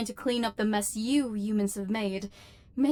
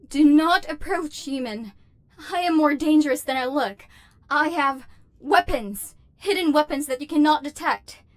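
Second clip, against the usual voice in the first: higher, louder nervous threat; louder, higher panicky bravado; alarmed warning; 5 semitones higher, 7 dB louder than usual. Acted. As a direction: bluff to keep them away. Robot warns approaching human.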